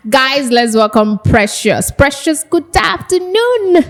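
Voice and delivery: high, drawn-out sing-out greeting; hyped-up welcome. Real, not acted. Host hyping up her co-host, excited welcome. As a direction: sing out the co-host's welcome, pitch climbing high.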